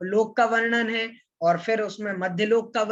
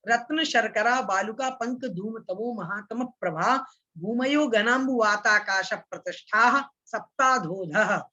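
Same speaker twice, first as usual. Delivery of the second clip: words joined in pairs, flowing on; the whole sutra in one go; recited all together. Shown as a demonstration. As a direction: run रत्न, शर्करा straight on, with no stop after each word. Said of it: "एक साथ मैं इसका उच्चारण करता हूं".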